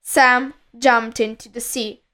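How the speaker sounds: ordinary delivery, nothing marked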